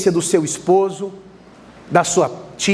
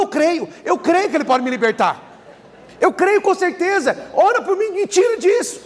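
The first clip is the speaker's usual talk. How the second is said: imitating desperate crying; 9 semitones higher than usual. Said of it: "começou a chorar desesperadamente".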